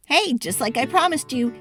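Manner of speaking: ordinary delivery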